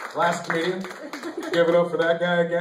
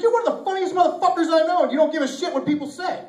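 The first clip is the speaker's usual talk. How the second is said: loud, high, fast mock rant; worked-up brotherly telling-off, acted out; brother laying into him; 10 semitones higher, 4 dB louder, faster than usual. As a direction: berate him with backhanded praise, pitched way up. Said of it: "he lays into me".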